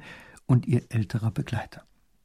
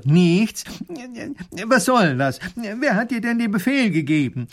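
high, louder incredulous professor; baffled annoyance; 10 semitones higher, 4 dB louder than usual. A narrator's reading. Professor unsure whether to wonder or fume, baffled. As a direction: echo it in disbelief, then demand answers. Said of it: "ob er sich wundern oder ärgern soll".

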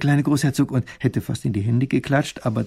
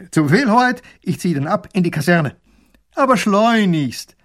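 put-on dutiful young soldier, higher and louder; 7 semitones higher, 7 dB louder than usual. Soldier reports proudly to the princess, eager and dutiful. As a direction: report eagerly like a loyal guard.

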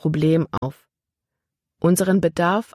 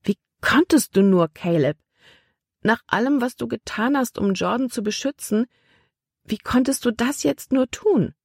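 higher, hurt accusation; accusing; 7 semitones higher than usual. A narrator's reading. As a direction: demand an answer from him. Libby accuses Caleb of betrayal. (vorwurfsvoll und verletzt)

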